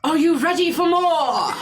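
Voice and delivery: a hint of a showman hyping the crowd; calling out. [name] calling to the crowd, lightly voiced showman call. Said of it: "he calls out".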